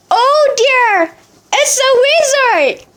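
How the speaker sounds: high, startled cry; startled high gasp. Acted. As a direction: blurt it out in shock. Fishermen gasp at the chicken.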